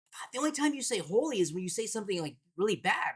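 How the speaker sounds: put-on a five-year-old explaining seriously; light mock little girl; imitating his daughter. Daughter quoted in a story.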